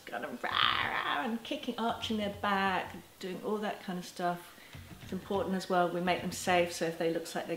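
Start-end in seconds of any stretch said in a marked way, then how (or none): 0.5-1.2 mock tantrum roar. Child tantrum being described.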